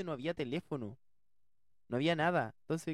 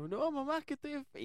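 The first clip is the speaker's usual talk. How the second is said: high-pitched mock-tearful whine; hammed-up crying voice, pitched way up; 14 semitones higher than usual. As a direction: wail back to mom in put-on tears. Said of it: "Yo estaba llorando".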